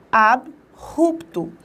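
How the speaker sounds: ABRUPTO as AB, pause, RUPTO; very slow ab-rupto, guttural r; correct syllable split. Shown as a demonstration. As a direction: slowly separate ABRUPTO after the b, r said like an h. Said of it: "a separação silábica também estará. Percebam".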